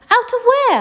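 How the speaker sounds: high, loud curious question; eager boy. Acted. Charlie asking Grandpa Joe, high eager boy voice. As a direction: ask quickly, eager.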